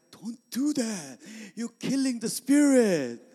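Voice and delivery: slow, drawn-out mock scolding; pastor's reproach. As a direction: imitate a pastor slowly pleading to stop. Pastor scolds the shouting deacon.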